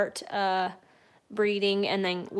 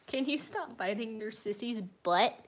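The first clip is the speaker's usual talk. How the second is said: ordinary delivery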